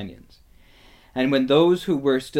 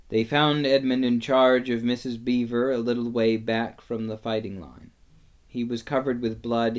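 usual speaking voice

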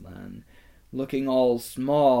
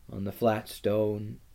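ordinary delivery, nothing marked

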